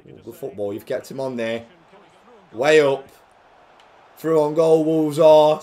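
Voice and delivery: normal talking voice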